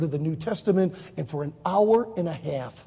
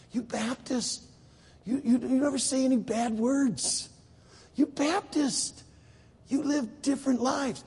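higher, drawn-out voice of bafflement; puzzled Israeli; 5 semitones higher, slower than usual. Retold or imitated. Israeli listing Baptist oddities to Jim, puzzled. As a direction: muse slowly on how strange these people are.

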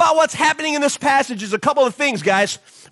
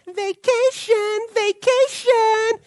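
high, slow singsong vacation, vacation; dreamy singsong; 9 semitones higher, much slower than usual. Pretend. Pastor picturing leaving for Hilton Head, high slow singsong. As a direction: stretch each vacation out like a happy chant.

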